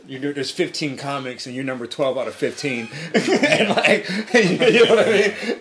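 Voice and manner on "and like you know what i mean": laughing through the words; can't stop giggling mid-sentence. Real, not acted. Laughing while telling story.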